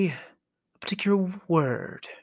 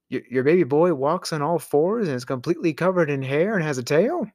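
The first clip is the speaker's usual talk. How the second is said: ordinary delivery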